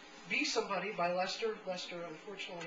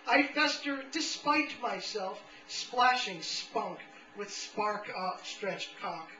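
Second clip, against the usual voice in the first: raised, slow, emphatic recital; declaimed poetry; 4 semitones higher, 6 dB louder, slower than usual. Acted. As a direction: declaim it grandly, easing down at the close. Reads a rewritten poem aloud.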